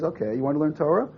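noncommittal retold question, rising at the end; understated questioning reply. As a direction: ask it back without enthusiasm. Rabbi's hesitant reply, retold.

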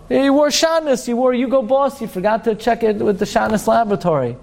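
high, mock accusation easing down at the end; hammed-up official reading out an offence; accusing court officer. Court telling a man he wore shatnez, mock accusing.

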